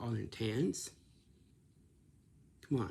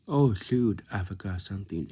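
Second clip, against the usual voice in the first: mock exclamation; 10 dB louder than usual. Shown as a demonstration. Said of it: "it's like".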